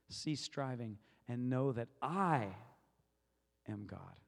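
"I" drawn out and lifted, long pause, hushed low ending; stress on I. Shown as a demonstration. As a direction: build slowly to a big "I", then drop. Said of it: "I'm gonna go to the next word".

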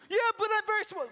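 a small scared man pleading; whiny Moses. Acted. Moses pleading with God, high and whiny. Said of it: "Moses answer in dialogue".

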